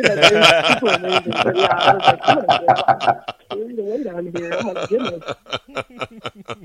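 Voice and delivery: giggling through the words; real laughing. Real, not acted. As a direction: tell it while breaking into laughter. Laughing while retelling her snorkeling scare.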